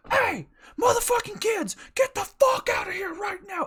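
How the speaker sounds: put-on high, loud roar at the kids; furious screaming. Enraged dad ordering his kids out, screaming. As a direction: bellow with apartment-shaking anger. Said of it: "his anger shook the whole fucking apartment".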